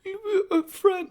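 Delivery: high, tearful imitation of a hurt girl; mock crying. Elle answers Mike after being sent away, mock crying. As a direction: whimper it in a mock sob. Elle's hurt reply, acted.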